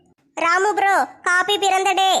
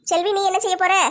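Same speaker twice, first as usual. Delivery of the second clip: ordinary talk, nothing marked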